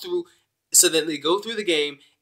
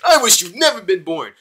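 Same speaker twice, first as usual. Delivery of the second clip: mean mom quote; 5 semitones higher, 6 dB louder than usual. Retold or imitated. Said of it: "she was going like".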